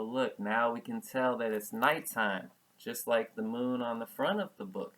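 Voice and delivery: plain reading voice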